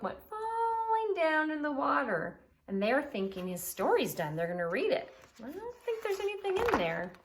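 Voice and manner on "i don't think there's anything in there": doubtful friend. Acted. Friend doubting book content.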